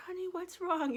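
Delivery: high, sweet nurse asking; lightly voiced caring nurse. Nurse gently asking the patient, high and sweet. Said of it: "I remember the nurse saying".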